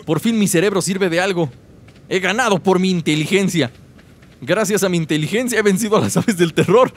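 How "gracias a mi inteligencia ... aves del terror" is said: ordinary talk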